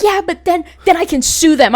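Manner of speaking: high, eager insistence; insistent mom. Retold or imitated.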